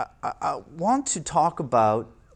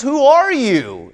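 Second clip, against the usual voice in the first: higher, louder, slow cry of "Who are you?"; startled question; 11 semitones higher, 10 dB louder, slower than usual. Acted. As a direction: call out to the unseen voice. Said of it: "the question goes".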